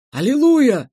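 high and loud joyful cry; exclamation. A narrator's reading. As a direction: shout it to the heavens. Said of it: "вскричал дирижёр".

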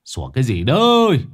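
irritated yell, swelling on the last word; shouted scolding. A narrator's reading. Man in bed yelling out at barking dog, annoyed shout. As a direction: call out sharply from indoors. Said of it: "mà quát vọng ra".

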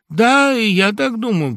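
ordinary delivery

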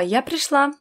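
high-pitched announcement of arrival; loud declaration. A narrator's reading. Daughter tells quarrelling parents she is here, declaring. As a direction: announce yourself clearly over a quarrel. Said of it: "громко заявила".

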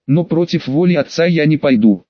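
usual speaking voice

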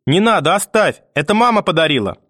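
put-on high, pleading child's shout; desperate crying out. A narrator's reading. Boy defends his mother's gift, desperate cry. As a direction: plead urgently, pitch leaping. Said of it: "кричал я".

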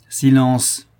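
loud, abrupt command for silence; sudden exclamation. A narrator's reading. Polidori hushes his companion to listen, sudden loud exclamation. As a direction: cry out to hush him. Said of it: "s'écria tout à coup Polidori".

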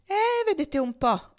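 high, slow, prissy mock gentleman; mock affected airs. A narrator's reading. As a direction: ape his affectation. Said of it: "sempre imitando i modi affettati di Adolfo". (parodia affettata, acuta e lenta)

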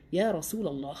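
respectful low voice. Shown as a demonstration. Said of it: "وبصوت منخفض".

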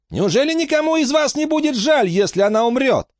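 very high-pitched incredulous question; irritated. A narrator's reading. Emma asking the onlookers, irritated high question. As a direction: ask it high, in exasperation. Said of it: "Эмма раздражённо оглянулась".

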